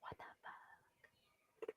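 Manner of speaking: playing along in a hushed whisper; secret-telling whisper. Host whispering back to co-host about dodging a charge, secretive whisper. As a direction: whisper back, drawn out, as if conspiring. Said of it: "I like how we're telling a secret".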